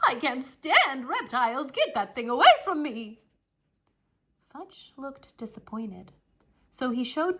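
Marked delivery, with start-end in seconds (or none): 0.1-3.2 higher, loud disgusted shriek; horrified lady's shriek. Acted. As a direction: recoil from the turtle with a cry. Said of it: "Missus Yarby shrieked".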